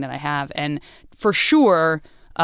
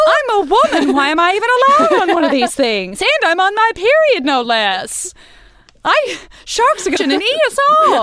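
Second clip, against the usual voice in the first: put-on much higher, louder old-time lady voice; 1940s voice; 13 semitones higher, 6 dB louder than usual. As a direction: fret like a 1940s passenger. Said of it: "I'm forced to use a nineteen forties voice".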